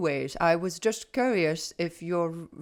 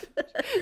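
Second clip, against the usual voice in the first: high laughing burst. Real, not acted.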